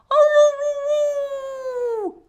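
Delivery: high loud howling woo; wolf howl. Shown as a demonstration. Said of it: "Can you howl?".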